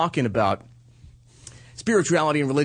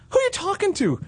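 much higher, puzzled wife voice; imitating wife. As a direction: play the wife calling out from inside the house. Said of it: "And Sarah goes".

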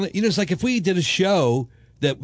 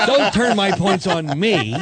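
slow, emphatic mock protest; mock indignation; 6 semitones higher, 4 dB louder, slower than usual. Co-host has his point turned on him, mock indignant. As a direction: push back in playful outrage, raised pitch.

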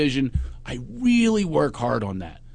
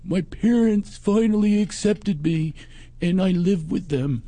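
imitating furry; 4 semitones higher, slower than usual. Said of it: "And then he says".

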